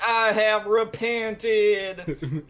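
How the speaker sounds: high, very slow, drawn-out confession; hammed-up contrite declaration; mock penitence. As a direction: wail it like a dramatic sinner. Rogue answers the party's scolding.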